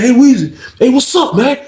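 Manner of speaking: high, pushy greeting; somewhat aggressive. Retold or imitated. Cheated man walking up at the rail, aggressive imitation. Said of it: "He kinda came aggressive with it".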